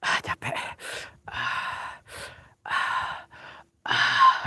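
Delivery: short unvoiced panting breaths. Real, not acted. Out of breath on the trail, panting. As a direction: gasp for air between words. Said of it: "gue ngos-ngosan".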